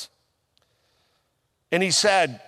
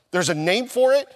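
higher, rising disbelief; incredulous, voice climbing at the end; astonished question; 5 semitones higher, 3 dB louder than usual. Retold or imitated. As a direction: ask it as if amazed to find out. Retold astonishment at a diagnosis.

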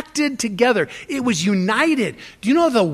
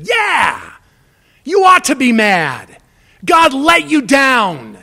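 high, loud mock agreement; egging-on friend; 5 semitones higher, 7 dB louder, slower than usual. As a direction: fire them up, take their side. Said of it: "We're gonna go to the brothers and sisters and say".

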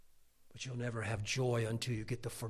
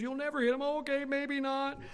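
high, loud tearful imitation; mock blubbering; 12 semitones higher, 5 dB louder than usual. Mom and son making up, mock blubbering. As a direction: keep up the hammed-up sobbing exchange. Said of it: "We would just slobber over each other".

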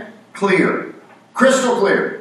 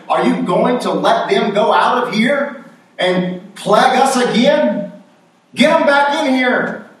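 raised, higher pleading servants' voice; pleading servants; 6 semitones higher than usual. Retold or imitated. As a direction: urge the king, voice pitched up. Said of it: "and they say".

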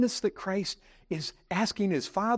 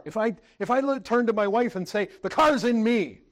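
higher, louder preaching at full force; impassioned preaching; 6 semitones higher, 4 dB louder than usual. Acted. Preacher urging the congregation, fervent raised preaching. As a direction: press the point hard on the congregation, voice raised. Preacher drives home the inness point.

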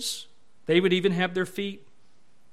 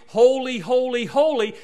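each "holy" raised and loud; emphatic repetition; 5 semitones higher, 7 dB louder than usual. Shown as a demonstration. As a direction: hit every "holy" firmly for emphasis. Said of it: "it is for emphasis".

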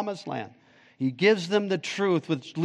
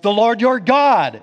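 "The Lord your God" higher, louder and slower; emphatic; 6 semitones higher, 6 dB louder than usual. Shown as a demonstration. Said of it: "it's up front for emphasis".

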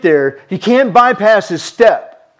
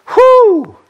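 high, sharp exhale of relief; a gasp of amazement; breathless whew of wonder; awed whew; 14 semitones higher, 4 dB louder than usual. Retold or imitated. Awe at Christ's work.

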